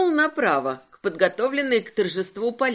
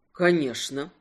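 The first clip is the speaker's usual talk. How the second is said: lower, serious male voice; serious man; 7 semitones lower than usual. Acted. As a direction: answer gravely, low. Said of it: "серьёзно подтвердил он".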